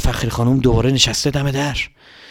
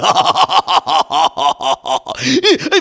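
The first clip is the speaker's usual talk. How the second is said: put-on slow evil old-woman laugh; eerie cackle; creepy witch cackle; 3 semitones higher than usual. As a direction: cackle like a child-eating witch.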